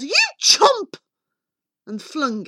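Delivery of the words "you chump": high, loud insult yelled out; angry yell. A narrator's reading. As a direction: yell it like a furious mother. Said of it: "and yelled".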